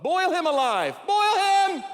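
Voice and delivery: high, loud mock frenzy; hammed-up mob cry for blood; bloodthirsty shout. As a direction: yell it like a mob at a lobster. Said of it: "we're like".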